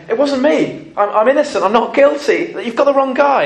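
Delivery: high-pitched urgent protest; acted desperate denial; protesting innocence. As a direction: plead innocence, voice pushed up high. Imagined innocent prisoner protesting.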